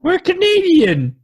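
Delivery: high, loud and drawn out; excited exclamation. Acted. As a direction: cry it out in mock protest. Jokey protest about being Canadian.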